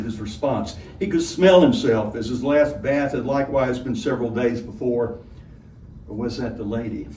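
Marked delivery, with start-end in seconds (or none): none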